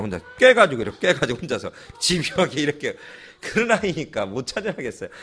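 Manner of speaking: usual speaking voice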